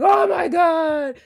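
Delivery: slow, loud, high overjoyed cry; excited shout. Retold or imitated. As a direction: gush it out at full volume. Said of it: "He is like".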